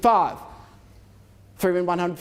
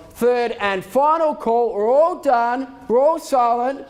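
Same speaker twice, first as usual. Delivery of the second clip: slow; 4 semitones higher, slower than usual. Real, not acted. Auctioneer drawing out final call.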